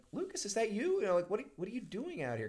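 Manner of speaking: high-pitched, lightly voiced girl calling to a friend; imitated girl's surprise. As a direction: lift the pitch high, questioning, without raising volume. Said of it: "she's like".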